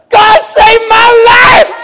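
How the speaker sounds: high, slow, booming shout; manic shout. Retold or imitated. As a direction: bellow it like a revival preacher. Blurts faith to stranger on bus.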